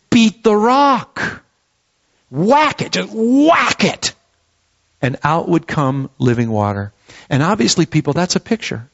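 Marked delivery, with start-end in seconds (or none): 0.1-4.1 high, drawn-out emphatic punch; emphatic whacking. Acted. Preacher acting out Moses striking the rock, emphatic and high. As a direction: land the words like blows of a staff.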